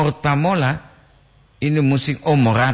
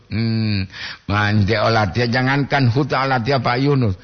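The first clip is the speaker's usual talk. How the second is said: ordinary talk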